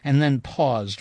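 usual speaking voice

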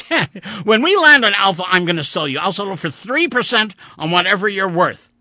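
high, loud, raging yell; furious shouting. Acted. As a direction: scream the threat at him. Furious rant at android.

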